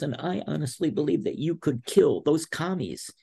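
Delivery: high, imploring imitation of a coach; retold tearful plea. Wrestler imitating his coach begging him, tearful. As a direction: plead in a high-pitched mock-emotional voice. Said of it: "he's got tears in his eyes".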